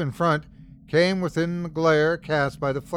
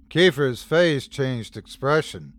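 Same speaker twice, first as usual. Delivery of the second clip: ordinary delivery, nothing marked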